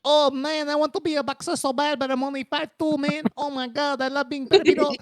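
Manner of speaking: high, hammed-up Filipino guy voice; mock Filipino character, high-pitched; Filipino accent. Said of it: "have I ever done the Filipino voice".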